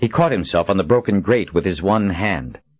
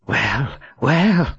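higher, gritted-teeth taunt; through gritted teeth; 6 semitones higher than usual. A narrator's reading. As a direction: spit it through clenched teeth. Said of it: "she said through gritted teeth".

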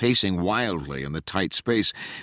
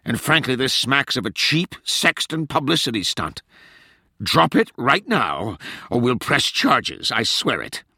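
higher, stern threat; snapping; 6 semitones higher than usual. A narrator's reading. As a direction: threaten firmly, slowing on the ultimatum. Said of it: "Tench snapped".

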